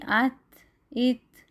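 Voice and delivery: ات with a slight whispered puff after the ت, said slowly; whispered t release. Shown as a demonstration. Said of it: "چھوٹی سی وسپر، یعنی سرگوشی کی آواز". (ات کو ہلکی سرگوشی کے ساتھ آہستہ ادا کریں)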